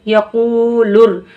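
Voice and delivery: यकूलुर drawn out very slowly, syllables joined smoothly; slow joined reading. Shown as a demonstration. Teacher shows students how to join यकूलुर slowly. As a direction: blend यकूलुर slowly, lam before ra. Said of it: "लाम रा पेश लुर".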